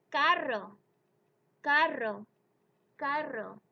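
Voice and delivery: carro with an unaspirated c and trilled rr; no puff on the c, roll the rr in carro; Spanish carro demo. Shown as a demonstration. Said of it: "let's try".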